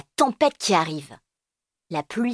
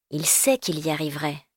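ordinary delivery

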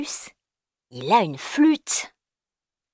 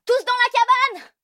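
very high, louder yell; 5 dB louder than usual. Acted. Firmin yelling everyone into the hut in the storm. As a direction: order everyone inside. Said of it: "Firmin hurle".

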